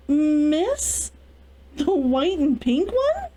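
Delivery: high, slow, astonished question; a maid stunned by the choice; gaping surprise. Acted. Maid shocked at the heirloom dress choice. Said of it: "Maya gaped at her".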